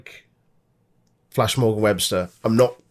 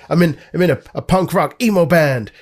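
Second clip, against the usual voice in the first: a lightly put-on American accent, pitched up; bad American accent; 7 semitones higher, 4 dB louder than usual. Co-host mocking Mark Andrews in a sketchy American accent. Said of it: "I don't know what I'm doing in American accent".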